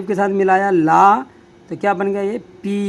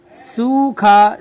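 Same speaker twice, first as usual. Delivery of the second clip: slow, drawn out; 3 semitones higher, 4 dB louder, much slower than usual. Shown as a demonstration. Teacher showing students सूखा, slowly drawn out. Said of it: "क्या है?".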